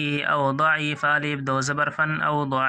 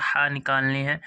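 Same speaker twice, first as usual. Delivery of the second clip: ordinary talk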